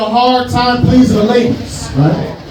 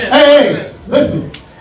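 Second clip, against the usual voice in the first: ordinary talk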